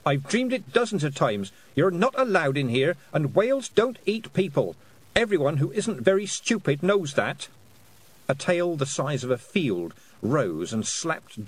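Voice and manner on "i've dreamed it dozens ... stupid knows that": put-on high, indignant girl's protest; angry shouting. A narrator's reading. Girl telling off the invading whale, high angry protest. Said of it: "she shouted at the sky".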